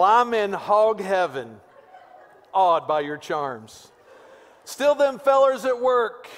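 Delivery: high, slow, hammed-up Texas drawl; Pastor Weaver's Texas accent. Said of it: "This is in my best Pastor Weaver, Texas accent voice".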